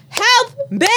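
put-on very high-pitched toddler yell; imitating a toddler. Mother re-enacts baby waking dad with claps, mock toddler yell. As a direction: squeal it like a demanding baby, breaking into laughter. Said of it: "and she goes".

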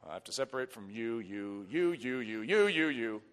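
low, hushed, repeated pointing out; quiet, low-pitched acted aside; mock separating. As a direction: count people off under your breath. Acts out separating from many members.

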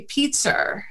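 "pizza" ending in an R, like "peetser"; Boston intrusive R. Shown as a demonstration. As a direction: close "pizza" on an added R, Boston-style. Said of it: "words that end in A".

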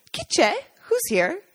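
put-on high curious child voice; curious preteen. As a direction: play the eleven-year-old girl. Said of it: "the curious preteen asks".

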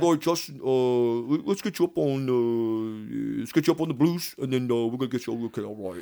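put-on lightly done Brighton English accent, breezy and quick; English accent, offhand; British accent. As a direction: imitate the Brighton choreographer giving you casual directions. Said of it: "he talks like this".

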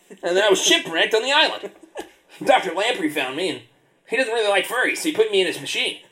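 high sea creature voice; lightly voiced squid. Squid tells backstory.

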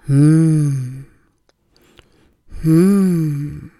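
mm, mm, easy and relaxed; comfortable humming; hum. Shown as a demonstration. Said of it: "aus diesem Brummton".

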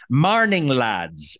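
drawn-out, slow Irish greeting; Irish accent. Retold or imitated. The doctor riding up on his bike, imitated Irish accent. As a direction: hail them in a slow Irish brogue. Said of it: "So he throws his Irish accent".

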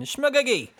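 mock exclamation. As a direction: blurt it out like a grumpy grandpa's outburst. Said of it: "maybe it was just, like, an exclamation".

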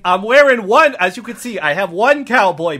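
put-on accented officer explaining himself haltingly; earnest improv cop excuse; defensive cop. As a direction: justify it as a flustered local cop. Cop explains his footwear.

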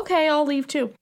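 high-pitched, agreeable imitation; light mock obliging voice; unbothered compliance. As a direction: give in readily, without any offense. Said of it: "he's not pissed".

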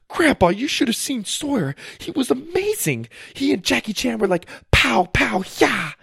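high, fast gushing voice; excited girl. Acted. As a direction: gush about the fight. Susie excited retelling.

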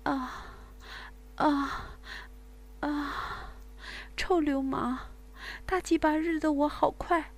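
high moans rising to loud cries; loud moaning. Acted. Woman crying out to her lover, loud moaning. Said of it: "大声呻吟着".

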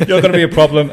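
loud, high, laughing through the words; mock the line while cracking up; laughing mock quote.

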